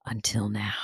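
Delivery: ominous tease. Pretend.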